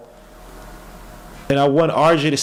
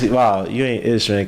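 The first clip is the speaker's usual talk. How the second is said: normal talking voice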